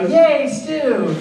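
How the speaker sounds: put-on high, cheery little-boy cheer; cheerful kid voice. Al cheering about stew, high kid voice. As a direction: chirp it like an excited kid brother. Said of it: "expecting to hear Al go".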